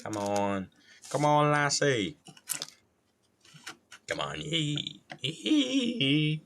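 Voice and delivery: hammed-up Australian calling out, rising high; badly faked Australian accent with squealed hees; bad gruff Australian. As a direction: attempt a gruff Australian and miss. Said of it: "gruff Australian, but I'm not really good".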